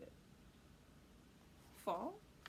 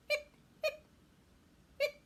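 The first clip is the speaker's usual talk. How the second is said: hiccup sounds; 7 dB louder than usual. Acted. Skeleton hiccuping.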